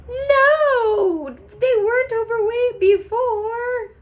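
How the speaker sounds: very high-pitched squeaky denial; hammed-up falsetto protest; feigned innocence. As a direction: play innocent in a tiny high voice.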